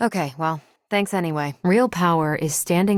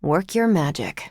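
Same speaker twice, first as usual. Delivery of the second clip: ordinary talk, nothing marked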